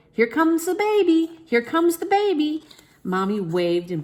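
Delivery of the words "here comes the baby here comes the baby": higher, louder cheerful announcing; a joyful shout, higher and louder. A narrator's reading. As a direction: call it down the hall. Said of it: "doctors and nurses shouted".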